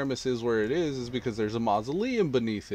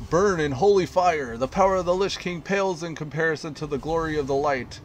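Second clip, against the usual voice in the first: raised, ringing holy fury; righteous inquisitor shout; 6 semitones higher, 7 dB louder than usual. Acted. Inquisitor proclaiming judgment on the mayor, loud zealous shout. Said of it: "he says".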